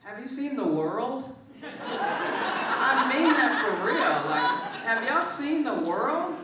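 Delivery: high, loud incredulous laugh-through question; laughing disbelief. Real, not acted. Laughing to the host about the world. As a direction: laugh in disbelief at the state of things.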